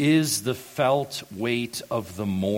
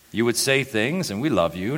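usual speaking voice

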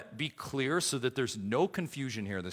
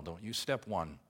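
normal talking voice

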